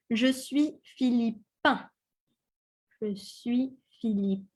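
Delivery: "Philippin" ending in a nasal un, no N heard; masculine Philippin. Shown as a demonstration. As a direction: end "Philippin" on a nasal vowel through the nose. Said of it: "the un sound ... comes from the nose".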